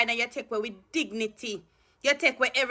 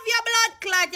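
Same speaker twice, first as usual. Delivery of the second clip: furious yell; 11 semitones higher, slower than usual. Real, not acted. Cursing a politician in a rant.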